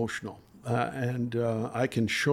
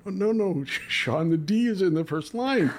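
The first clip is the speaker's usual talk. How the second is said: higher and louder, urgent and disbelieving; frantic plea to fix the answer; exasperated inner cry; 7 semitones higher, 4 dB louder than usual. Retold or imitated. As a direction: blurt it out as if you can't believe what he did. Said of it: "I'm saying this to myself".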